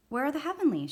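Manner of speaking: a touch of eager, high questioning; eager classmate. A narrator's reading.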